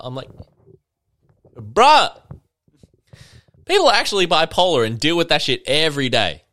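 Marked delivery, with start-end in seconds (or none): 1.6-6.4 louder, higher exasperated comeback; loud retort. Retold or imitated. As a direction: scold the faker loudly. Retorting to a faker.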